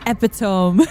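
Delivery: Epitome said as EP-ih-tohm; mispronounced epitome. Retold or imitated. Streamer echoing a viewer's misreading, Epitome mispronounced as EP-ih-tohm. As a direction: say Epitome like "epi-tome", rhyming with home. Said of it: "He said epitome".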